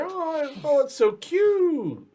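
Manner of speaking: high, gushing goblin voice; cooing goblins. Acted. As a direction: gush over the cute bunny in a high voice. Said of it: "And you hear".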